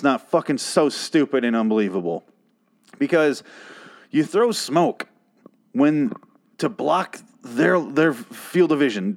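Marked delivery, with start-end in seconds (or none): none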